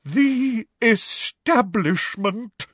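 very slow, high, dramatic quaver; melodramatic, stretched-out pronouncement; dramatic, wavering. A narrator's reading. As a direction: draw it out with wavering theatrical portent. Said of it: "in a deep, dramatic, wavering voice".

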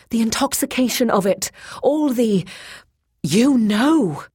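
high, impassioned, building urgency; eager. A narrator's reading. Boyce luring Grey Alis to change and hunt, eager. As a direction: entice her with mounting fervour. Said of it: "he said eagerly".

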